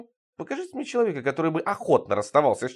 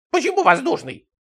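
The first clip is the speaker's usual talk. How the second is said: very high, louder indignant squeal; agitated old neighbour; 13 semitones higher, 5 dB louder than usual. A narrator's reading. Сидорыч questioning the insult, high agitated cry. Same outraged neighbour, acted voice.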